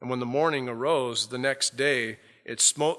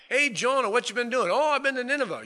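cheery greeting; 11 semitones higher, 4 dB louder, faster than usual. Retold or imitated. Neighbours greeting returning Jonah.

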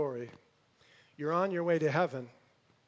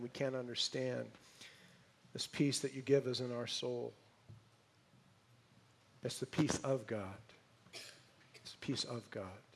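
hushed, reverent prayer voice; 4 semitones lower than usual. Acted. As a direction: pray gently over the congregation. Leads a closing prayer.

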